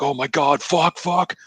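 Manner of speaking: high-pitched mock panic; Hudson. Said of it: "and Hudson's like".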